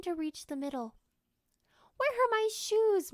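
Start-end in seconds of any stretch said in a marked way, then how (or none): 2.0-3.1 high, loud, slow puzzled call; puzzled asking. Acted. Father looking for his shoes, high puzzled call. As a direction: call out, searching. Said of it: "Mark asked one morning".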